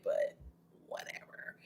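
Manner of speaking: breathy whisper of whatever, trailing off; whispered aside. Real, not acted. Mutters to viewers about the chapter she dislikes, whispered. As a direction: drop to a whisper to brush it off.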